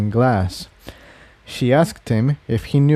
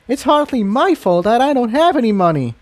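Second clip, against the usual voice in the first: indignant mare voice, much higher; huffy; 11 semitones higher than usual. Acted. Refugee mare answering the old pony, indignant. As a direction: defend yourself, offended. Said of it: "Incendia huffed".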